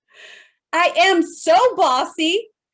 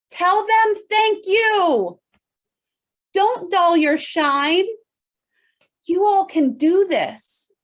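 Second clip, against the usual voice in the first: emphatic; 3 semitones higher, slower than usual. Real, not acted. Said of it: "slow rallying lines".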